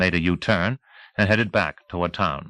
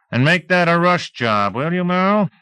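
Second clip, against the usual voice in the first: loud mocking call; 10 semitones higher, 4 dB louder than usual. A narrator's reading. Teasel calls out loudly.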